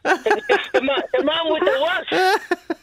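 lightly put-on Castleknock women. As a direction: imitate the class ladies asking after someone. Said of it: "the women from Castleknock would be like".

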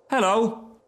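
put-on high, surprised boy's voice; boy crying out. A narrator's reading. Boy spotting something in the ditch, high cry. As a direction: call out like a little boy. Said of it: "cried one of the boys".

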